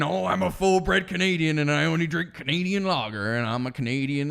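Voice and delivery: mocking braggart; mock boasting. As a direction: imitate a puffed-up patriot, settling as it goes. Mimicking full-bred Canadian boasting.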